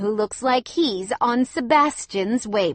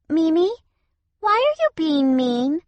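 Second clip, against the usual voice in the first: put-on high child's voice, louder; little girl asking; 9 semitones higher, 6 dB louder than usual. A narrator's reading. Cassia asking her grandmother why she is mean. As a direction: ask like a puzzled small child. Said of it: "Cassia asked".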